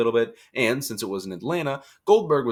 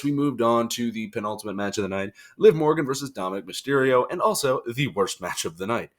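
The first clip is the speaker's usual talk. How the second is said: usual speaking voice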